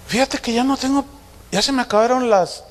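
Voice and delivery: tactful daughter hinting. Retold or imitated. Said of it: "busca la manera de decírmelo".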